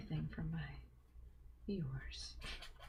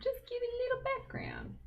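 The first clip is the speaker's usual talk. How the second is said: "Just give it a little background" said much higher, with a falling end; high silly voice; faster than usual. Shown as a demonstration. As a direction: jump way up in pitch for a playful repeat. Repeats phrase in high voice.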